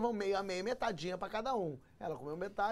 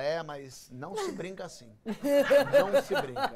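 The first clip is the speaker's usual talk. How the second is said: slow mock-solemn reproach, building louder; offended wife; 2 semitones higher, 5 dB louder, slower than usual. Wife still scolding husband for joking, slow grave reproach. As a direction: draw out the telling-off.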